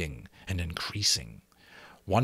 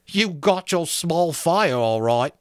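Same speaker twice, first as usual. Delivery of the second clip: sour sarcasm again; 8 semitones higher, 5 dB louder than usual. A narrator's reading. Repeated, slower sarcastic line.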